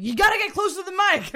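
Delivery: quick lecturing imitation; imitating Joe Rogan. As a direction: lightly mimic a lecturing host. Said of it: "Joe Rogan, like, constantly lecturing".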